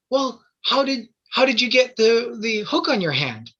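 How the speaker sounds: put-on high, curious kid voice; little boy voice. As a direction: ask it like a wide-eyed little boy.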